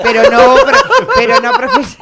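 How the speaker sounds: voz aguda entre risas; riendo. Real, not acted. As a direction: casi sin poder hablar de risa. (riéndose)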